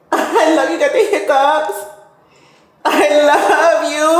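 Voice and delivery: high, slow doting coo. Real, not acted. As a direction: coo lovingly at the pet. Cooing at her dog.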